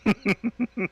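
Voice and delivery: high giggling burst; burst of laughter. Real, not acted. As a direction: laugh out loud. Laughing at ecchi show.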